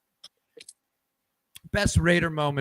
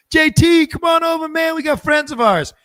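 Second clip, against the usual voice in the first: shouted high and loud across the course; big shouted welcome; 13 semitones higher, 8 dB louder than usual. Retold or imitated. Golfer yelling to his old teammate to come over, big shout. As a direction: call him over from across the fairway. Davis calls Tatum over.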